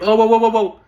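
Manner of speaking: loud, high-pitched babble of bickering; mock squabbling noise. Mimicking Polish people bickering among themselves, mock squabbling. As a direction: imitate a noisy quarrel as one loud run of whoas.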